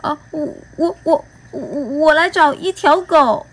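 high, halting stammer; nervous stutter at the monsters. Acted. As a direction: stumble over the first word. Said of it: "我结结巴巴地说".

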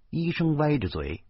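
usual speaking voice

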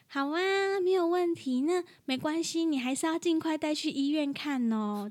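high, sweet, overly agreeable phone voice; sweet wife phone voice. Retold or imitated. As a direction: chirp it sweetly like a polite receptionist. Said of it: "我有没有觉得很像？".

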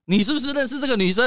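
high, loud, accusing question; wife's angry accusation. Retold or imitated. Retelling his wife in the nightclub, angrily accusing him. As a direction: demand an answer from him. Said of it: "我老婆也很生气".

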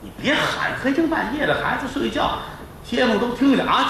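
high, urgent pleading to keep it down; anxious wife shushing her husband; lightly voiced fretful wife; hushing wife. Wife hushes husband at night.

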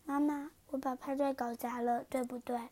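soft, sheepish question; small voice. Acted. Princess quietly asking her mother, softly. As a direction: say it softly, ashamed. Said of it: "她小声地说".

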